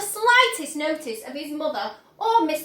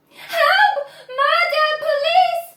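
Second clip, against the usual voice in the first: very high, loud hysterical screams; screaming; 13 semitones higher, 8 dB louder, slower than usual. Acted. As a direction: shriek for help hysterically. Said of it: "screamed Missus Gloop".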